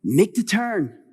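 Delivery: re-enacted yell to someone ahead; retold yelling. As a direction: call out a warning from behind, half yelled. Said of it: "I'm yelling behind her".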